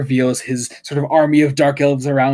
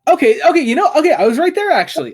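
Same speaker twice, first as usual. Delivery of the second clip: high, loud excited protest; 6 dB louder, faster than usual. Real, not acted. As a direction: burst out excitedly, pitched up high. Excited reaction to pronunciation.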